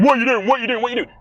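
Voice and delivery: imitated shouting. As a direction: imitate someone yelling the same demand over and over. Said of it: "he's constantly shouting at Zuko".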